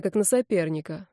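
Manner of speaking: ordinary delivery, nothing marked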